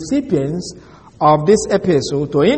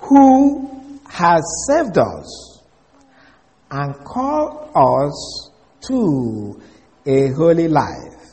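scripture recitation; much slower than usual. Acted. Teacher reciting Timothy to students, slow solemn scripture voice. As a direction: read the verse out slowly and reverently. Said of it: "Second Timothy one nine says".

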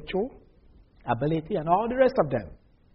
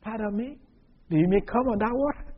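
Peter, doubting; 6 semitones higher than usual. Retold or imitated. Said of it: "Peter said".

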